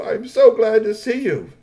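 high, loud, slow exclamation; joyful Star-Lord. A narrator's reading. Star-Lord greets the prince he found, joyful high cry. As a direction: draw the greeting out with delight.

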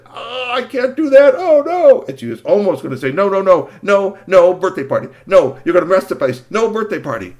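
high, loud refusing no no no; panicked witch. Acted. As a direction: flap in protest. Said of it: "She said".

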